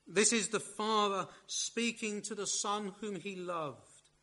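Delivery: ordinary delivery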